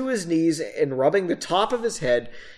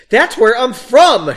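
loud, high, slow emphatic cry; exclaimed; 7 semitones higher, 10 dB louder than usual. A narrator's reading. Narrator redoing the line, exaggerated exclamation. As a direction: belt it out, hammed up. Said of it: "she exclaimed".

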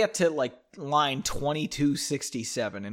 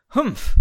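humph; 8 semitones higher, 7 dB louder than usual. Acted. Old man grunts.

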